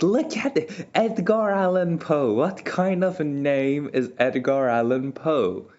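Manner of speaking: high, emphatic jab at the name Edgar Allan Poe; mock indignant. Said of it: "And I was like".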